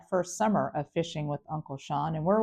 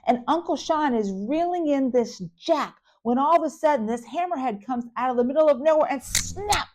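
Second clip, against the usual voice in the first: higher and louder, racing through the tale; breathless excitement; 8 semitones higher, 4 dB louder than usual. Real, not acted. Mom telling the shark story, higher, excited. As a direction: build the shark drama up.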